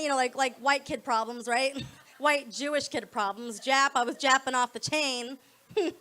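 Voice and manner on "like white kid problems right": ordinary talk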